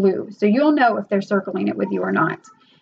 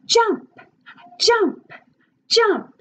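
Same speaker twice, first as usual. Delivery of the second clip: much higher, bouncy calls to jump; excited teacher prompt; 10 semitones higher, much slower than usual. Shown as a demonstration. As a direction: cheer the child to jump.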